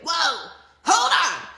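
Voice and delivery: loud, high, drawn-out halt; mock freak-out shout. Woman acting out freaking out, mock shout. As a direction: holler it slowly, as if stopping everything.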